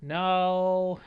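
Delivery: loud, drawn-out protest; long, loud groaning no; dismayed refusal. Real, not acted. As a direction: stretch out each loud no in dismay. Dismayed at the game line.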